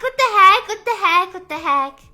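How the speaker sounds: normal talking voice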